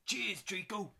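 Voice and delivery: high, loud cockney thanks; chirpy cockney lad. Acted. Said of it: "Kyle is a bit cockney".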